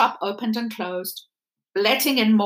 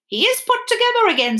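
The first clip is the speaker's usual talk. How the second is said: higher, fast, pleased declaration; a touch of pride in his work; proud mender; 13 semitones higher, 4 dB louder, faster than usual. Acted. Lucius telling Bryce the rabbit is mended, proudly.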